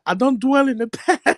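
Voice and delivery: high mock female voice; pleading woman. Playing a woman who refuses to look back, high mock voice. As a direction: imitate a woman brushing off her past. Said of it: "she'll be like".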